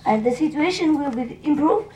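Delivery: high, eager hopeful question; hopeful surprise. Retold or imitated. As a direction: ask brightly, hoping it's true. Disciple hopes for happy ending.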